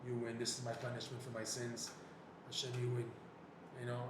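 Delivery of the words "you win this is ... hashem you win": soft, broken plea through tears; hushed, choked-up prayer of defeat; tearful prayer. Retold or imitated. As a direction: surrender quietly, almost weeping. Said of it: "So I started to cry".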